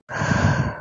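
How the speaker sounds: soft breathy sigh; calming sigh. Acted. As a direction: let the anger out. Calming down after anger.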